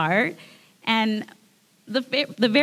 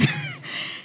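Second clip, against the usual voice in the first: a short high embarrassed laugh; a quick sheepish laugh; breathy laugh; 8 dB quieter than usual. Real, not acted. Embarrassed laugh mid-sentence.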